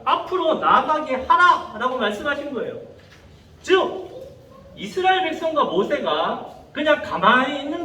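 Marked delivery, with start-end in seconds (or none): 0.0-1.8 acted divine command, each word driven home; forceful command. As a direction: order them onward as God commanding Moses. God's command, acted after plain reading.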